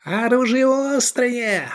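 a sudden high shriek; shrill squeal. A narrator's reading. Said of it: "проверещал он".

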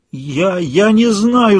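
high, slow, drawn-out wail. A narrator's reading. Woman who cannot recall her name, wailing. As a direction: wail it out in despair. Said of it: "завыла она".

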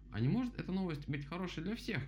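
high-pitched storybook rabbit; voiced rabbit character. A narrator's reading. Rabbit asks for plain words, high rabbit voice.

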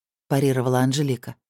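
plain reading voice

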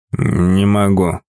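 put-on slow, low drunken mumble. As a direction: mumble it half asleep and drunk. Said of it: "честно промычал Игорь Вадимович".

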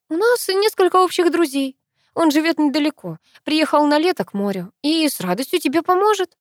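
high-pitched, nervous lie; squeaky lying. A narrator's reading. Granddaughter lies to grandmother about Михаил, squeaky. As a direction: pitch it up like a bad liar. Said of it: "Каждое новое предложение я произносила на полтона выше".

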